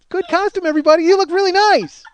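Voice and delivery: high, loud sing-song compliment; mock wife. Husband mocks his wife's compliment to the sick man, high and loud. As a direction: overdo the cheery greeting.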